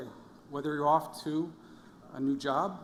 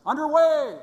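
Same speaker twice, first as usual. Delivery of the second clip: high, very loud shipboard call; boatswain's yell; 12 semitones higher, 13 dB louder than usual. Acted. Boatswain's mate calls the crew underway, yelling. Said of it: "the boatswain's mate yells".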